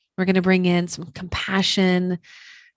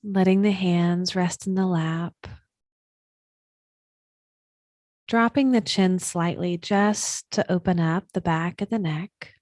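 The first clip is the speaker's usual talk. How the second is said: guided meditation. Acted. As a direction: settle them into stillness, unhurried and even.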